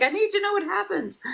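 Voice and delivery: high pleading stepdaughter voice; demanding stepdaughter. Retold or imitated. Stepdaughter begging to know the ending, high and pleading. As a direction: whine it high like an impatient kid. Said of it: "she's like".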